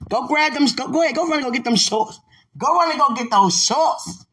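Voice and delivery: high, loud, giddy cheering-on; playful urging. Pretend. Teasing sweetheart to go get shorts, playfully excited. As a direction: egg them on in a high, excited yell.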